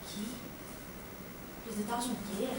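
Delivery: normal talking voice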